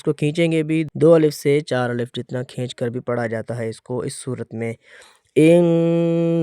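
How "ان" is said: ان stretched long and chanted; ان drawn out in recitation; correct madd. Shown as a demonstration. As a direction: hold the vowel of ان for two to four beats. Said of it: "اتنا، اس طرح اس کو صحیح پڑھا جائے گا".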